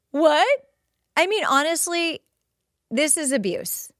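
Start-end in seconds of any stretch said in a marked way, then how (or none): 0.1-0.5 high, loud incredulous "What?"; shocked what. Real, not acted. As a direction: gasp it out in disbelief. Shocked at father's words.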